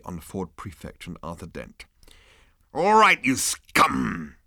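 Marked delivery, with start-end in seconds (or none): 2.7-4.3 higher, louder mock menace; officer threatening prisoners; hammed-up growl. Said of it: "he growled".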